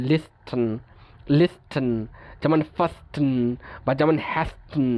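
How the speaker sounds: লিস্টেন, ফাস্টেন, হ্যাস্টেন with t sounded before n; T pronounced. Shown as a demonstration. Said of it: "উচ্চারণ করতে কষ্ট হচ্ছে".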